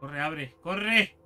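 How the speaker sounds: panicked loud urging; urgent, rising panic; being chased. Real, not acted. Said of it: "¡Qué susto!".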